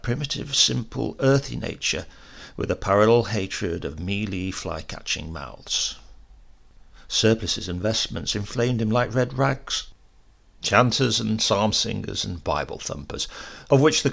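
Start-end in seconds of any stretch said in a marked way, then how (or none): none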